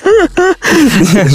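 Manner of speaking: a real high laugh at his own confession. Real, not acted. Laughs at own TikTok joke.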